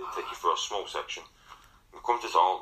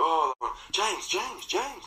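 calling out; 12 semitones higher, much slower than usual. Retold or imitated. Calling out to fleeing friend.

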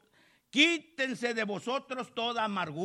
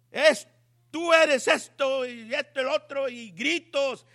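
mock yelling at husband; 4 semitones higher than usual. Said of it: "Gritarle al esposo".

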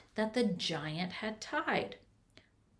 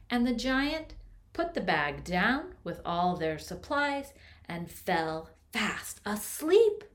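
plain reading voice

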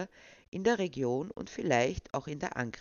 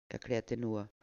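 usual speaking voice

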